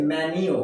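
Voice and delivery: "মেনু" said as "MEN-yo", y glide; correct pronunciation. Shown as a demonstration. Teacher giving learners the proper form, "মেনু" as MEN-yu. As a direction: say "মেনু" with "nyu" at the end. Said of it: "এইটা হচ্ছে".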